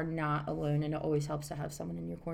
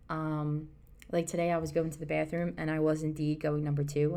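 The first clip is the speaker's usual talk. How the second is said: normal talking voice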